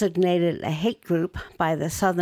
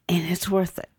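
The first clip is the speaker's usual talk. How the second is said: whispered. Real, not acted. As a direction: breathe it out, sincere. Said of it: "heartfelt close".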